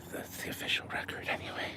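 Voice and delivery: a hushed, knowing aside; whispered aside. Real, not acted. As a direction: drop to a whisper as if sharing a secret. Conspiratorial aside after praising chatter.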